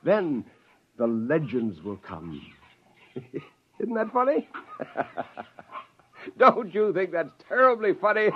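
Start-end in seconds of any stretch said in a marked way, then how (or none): 3.8-8.3 much higher, giggling gloat; delighted with his own scheme; gleeful laughter. Acted. Scientist gloating to creatures about his plan, giggling.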